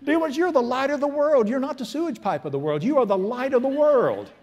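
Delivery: loud, high preaching cry; preacher's shouted proclamation; full-force preaching. Acted. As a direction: proclaim it to the crowd.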